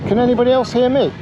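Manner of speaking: calling out. Real, not acted. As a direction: call out to everyone on the headset. Calling over the headset.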